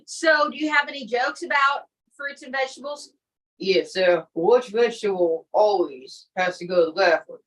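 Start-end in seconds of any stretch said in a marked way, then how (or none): 3.6-7.4 lightly voiced low comic character; a hint of a lounge comic; corny joke-teller. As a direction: deliver corny riddles in a lower put-on voice. Comic character tells corny jokes.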